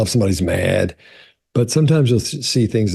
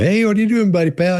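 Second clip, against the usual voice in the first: much higher, sing-song hello to an animal; chirpy visitor greeting; 12 semitones higher than usual. Retold or imitated. Visitors greeting the aquarium seal, high sing-song voice. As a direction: coo at the seal like a visitor at the tank. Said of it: "people go up to the seal and go".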